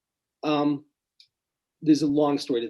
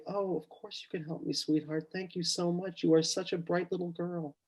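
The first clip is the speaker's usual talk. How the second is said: soft, higher-pitched reassurance; imitated loving mother; 5 semitones higher, 8 dB quieter than usual. Mother thanking her little girl, imitated tender reassurance. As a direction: play a tender mother, hushed and soothing.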